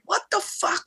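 squeaked way up high, stunned; a high, baffled squeak of disbelief. Retold or imitated. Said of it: "I'm like".

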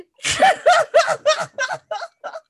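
loud, high burst of laughter; real laugh. Real, not acted. Laughing at the absurd deathbed scene, a loud real laugh. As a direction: laugh helplessly hard.

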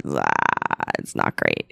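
deep deadpan verdict. Retold or imitated. Watching herself from outside as a third party, deep dry mock verdict. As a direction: drop way down and state it flatly. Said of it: "you go".

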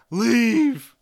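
loud furious yell; angry shout. Retold or imitated. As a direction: bark the order to leave. Zuko yells at the gang.

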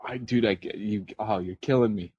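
ordinary delivery

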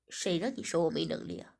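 smug male voice. Acted. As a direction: shoot back low.

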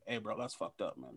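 low, hushed word in his ear; discreet aside. Acted. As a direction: lean in and quietly caution a bro. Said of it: "just to lean in his ear".

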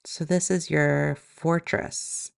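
calm, even meditation voice with long pauses; guided meditation. Acted. Yoga teacher guiding listeners into body and breath, guided meditation. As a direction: guide them gently inward, leaving space between phrases.

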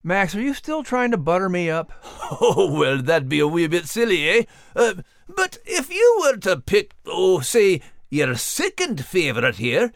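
lightly Scottish-tinged character, coaxing; playful wheedling voice. Acted. Max tries to butter up the announcer, coaxing.

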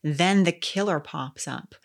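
normal talking voice

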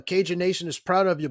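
ordinary talk, nothing marked

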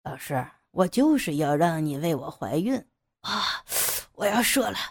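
low, provocative voice; deliberate taunt. Acted. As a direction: say it on purpose to torment her. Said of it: "杨野故意说道". (故意低沉挑衅)

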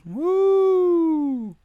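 ordinary talk

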